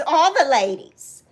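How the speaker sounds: ordinary talk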